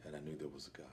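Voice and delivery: hushed whisper of a realization. Real, not acted. Former inmate telling the interviewer he found God, whispered. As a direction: breathe it out almost silently.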